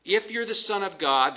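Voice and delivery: doubting challenge. Acted. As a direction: plant a sneering seed of doubt. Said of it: "that little bit of doubt".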